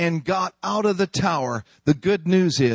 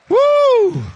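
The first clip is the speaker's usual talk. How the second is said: exultant whoop; 8 dB louder than usual. Real, not acted. Preacher's exultant cry.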